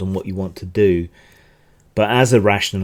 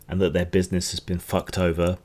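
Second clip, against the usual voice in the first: ordinary talk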